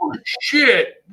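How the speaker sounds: loud, very high retold exclamation; mock alarmed outcry. Acting out the man walking in, loud mock alarm. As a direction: blurt it out high in mock shock. Acting out the man's sudden realization.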